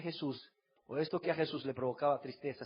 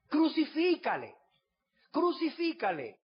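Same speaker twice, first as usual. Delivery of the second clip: mob shouting; 11 semitones higher than usual. Acted. Mob demands crucifixion.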